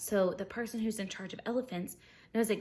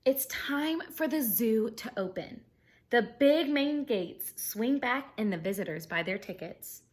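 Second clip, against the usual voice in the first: bright, lively storybook reading; animated read-aloud for kids; reading aloud to children; 3 semitones higher, 5 dB louder than usual. Acted. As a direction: read it like story time for a class. Reading picture book to class.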